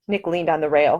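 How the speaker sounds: plain reading voice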